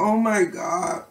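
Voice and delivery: drawn-out high exclamation; sour shock. Real, not acted. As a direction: wince through the sour taste. Reacting to sour candy.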